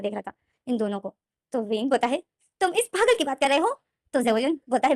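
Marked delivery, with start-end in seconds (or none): 2.6-3.7 sharp, higher, louder disbelief; indignant, voice jumping up; angry outburst. Acted. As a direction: snap it out in outrage. Wing angry at his brother-in-law.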